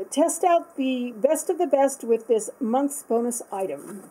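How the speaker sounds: slow, read-out advert line; promo line read. Acted. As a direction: read it like a sales slogan. Reads promo line from card.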